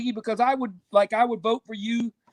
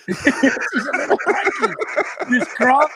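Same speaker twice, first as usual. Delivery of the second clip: slow, higher, laughing Australian echo; Australian impression; 5 semitones higher, slower than usual. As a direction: echo the croc line through a laugh. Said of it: "By crikey".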